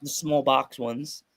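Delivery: ordinary delivery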